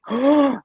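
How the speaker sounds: high, loud intake of breath; sharp gasp. Retold or imitated. Son realizing how his sisters felt, imitated gasp. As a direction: gasp as if it just dawned on you. Gasps at end of retold exchange.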